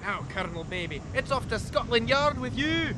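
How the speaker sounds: loud, slow official arrest; stern inspector. Acted. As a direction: haul him off, commanding. Arresting villain in scene.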